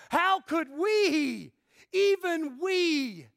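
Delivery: "we" high, loud and drawn out; slow right down and cry out "we" both times; emphatic WE. Shown as a demonstration. Preacher voicing Paul's question in verse two, ringing stress on "we". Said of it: "the emphasis is emphatic in the Greek".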